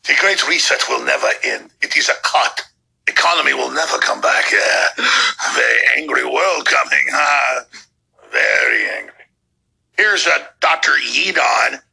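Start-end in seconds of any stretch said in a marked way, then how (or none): 0.0-9.1 low mock German villain voice; Klaus Schwab. Host showing how the leader acts, low German-accented villain impression. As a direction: put on a deep, creaking Bond villain with a bad German accent. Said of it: "that's how he acts".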